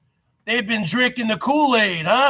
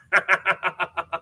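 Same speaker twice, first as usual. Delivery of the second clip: mocking laugh; 4 semitones lower, 5 dB quieter than usual. Mock laugh inside rival impression.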